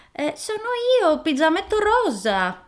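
put-on the wolf squeaks high to pass for the girl; wolf disguising voice. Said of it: "disse il lupo cambiando la sua voce".

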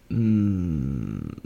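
usual speaking voice

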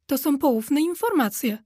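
usual speaking voice

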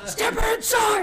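high, slow, blaring shout; startled shout. Retold or imitated. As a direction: yell it like the radio's blasting. Blasting radio wakes her.

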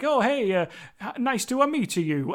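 mock Italian-English lilt, lightly done; bad Italian accent. Podcaster imitating Italian actors speaking English, bad Italian accent. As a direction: ham up a clumsy Italian accent. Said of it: "have a terrible accent here".

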